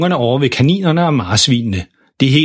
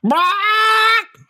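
meget højt, langtrukket fugleskrig; skræp som en forskrækket papegøje; skrigende papegøje. Acted. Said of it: "nærmest skriger". (papegøjeskrig)

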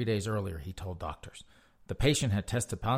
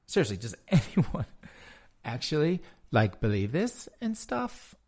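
mocking uptalk, higher and dragged out; mock valley girl; 5 semitones higher, 3 dB louder than usual. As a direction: parody the sing-song rising tone.

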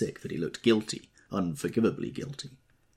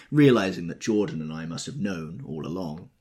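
ordinary delivery, nothing marked

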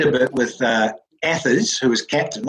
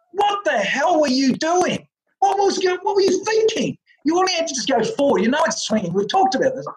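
much higher, scolding, mock exasperation; exasperated teammate; 13 semitones higher than usual. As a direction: tell him off like an incredulous partner at the crease.

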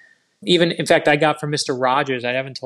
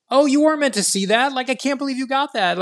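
much higher, incredulous gasp of a reply; stunned disbelief; 11 semitones higher than usual. Retold or imitated. Author stunned when Justice Ginsburg phones him, high disbelieving squeak. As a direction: sound floored that she called.

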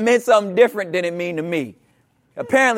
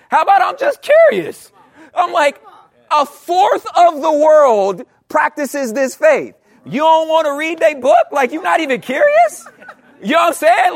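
high, loud animated plea; fired-up rhetorical plea; excited emphasis; 9 semitones higher, 7 dB louder than usual. Real, not acted.

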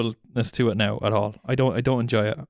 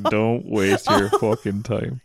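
laughing mock quote; 3 semitones lower, much slower than usual. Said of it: "was like".

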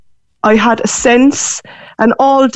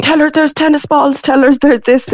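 excited calling out; 6 semitones higher than usual. Retold or imitated. Boyfriend calls out during the phone call, excited mock shouting. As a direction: imitate an excited call from the background. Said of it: "her boyfriend in the background shouting".

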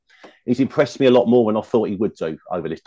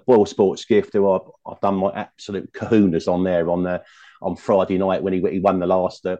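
ordinary talk, nothing marked

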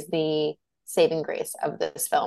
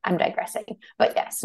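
ordinary delivery

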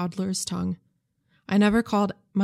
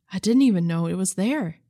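ordinary delivery

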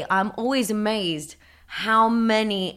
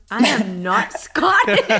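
laughing Liverpudlian; 4 dB louder, slower than usual. Retold or imitated. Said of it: "the Liverpudlian accent".

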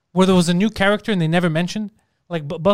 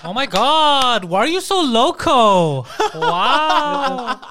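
slow, drawn-out flamboyant delivery; theatrical camp voice; 5 semitones higher, much slower than usual. Acted. Flamboyant actor greets the bikers, theatrical. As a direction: stretch every word like a gushing theater guy. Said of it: "That's a theater voice".

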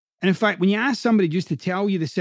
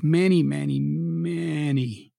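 drawn out; much slower than usual. Acted. Exaggerating many years coaching.

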